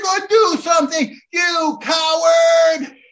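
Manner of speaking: high, loud, taunting yell; kids yelling. Retold or imitated. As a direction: shout 'coward' at him, slowly. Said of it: "The kids are screaming".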